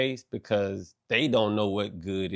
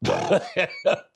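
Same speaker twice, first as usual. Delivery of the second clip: breathy laugh; 8 semitones higher than usual. Real, not acted. Laughs at own bit.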